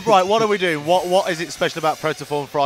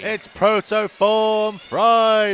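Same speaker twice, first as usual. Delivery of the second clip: slow, loud, higher announcer call; hyped announcer; 6 semitones higher, 5 dB louder, much slower than usual. Acted. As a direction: stretch it out like a show opener. Co-host echoes the announcement.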